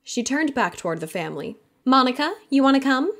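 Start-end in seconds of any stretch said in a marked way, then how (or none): 1.8-3.1 higher, louder, mock-sweet sister; teasing her brother with fake sweetness; facetious. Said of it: "she added facetiously".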